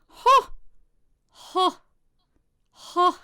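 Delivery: high, loud, slowly spaced huh laugh; three slow separate laughs. Retold or imitated. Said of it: "then she'll go".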